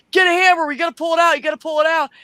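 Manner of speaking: high, loud faked emergency orders; mock urgent yell. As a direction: bark for the hammer as if hurt. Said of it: "he's like".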